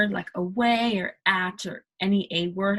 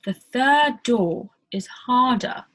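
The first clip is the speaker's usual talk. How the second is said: slow, louder British accent, higher pitch; slow British demo; 5 semitones higher, 8 dB louder, slower than usual. Shown as a demonstration. Teacher slowly demos a British sentence for learners. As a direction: carefully pronounce each word British style. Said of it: "door sounds like D A W".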